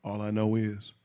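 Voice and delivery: low, soft seductive voice; sexy voice. Pretend. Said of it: "my sexy voice is right here".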